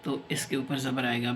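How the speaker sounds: ordinary talk, nothing marked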